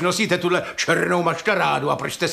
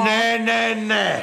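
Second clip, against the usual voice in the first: higher, shouted no, no, no; stubborn landowner shouting that he won't leave; shouted refusal; 5 semitones higher, much slower than usual. Acted. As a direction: dig in and yell it.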